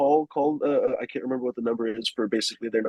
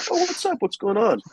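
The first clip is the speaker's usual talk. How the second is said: high, breezy greeting in a cop's voice; airy, high-pitched friendly officer; 11 semitones higher than usual. Retold or imitated. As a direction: play a cheery policeman saying hello. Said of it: "the cop is like".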